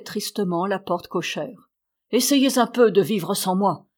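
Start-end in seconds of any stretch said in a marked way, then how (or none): none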